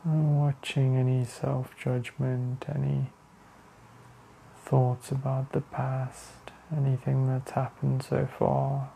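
slow, even meditation voice with long pauses; guided meditation. Real, not acted. Leading listeners to the breath, calm meditation voice. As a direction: guide attention inward, unhurried and steady.

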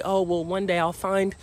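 a hint of a naive dreamer; mock old self. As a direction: tease your younger daydreaming self. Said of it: "I used to say".

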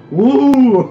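loud squeaky woo; high loud creature whoop. Retold or imitated. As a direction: whoop like a giddy fish. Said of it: "It's like".

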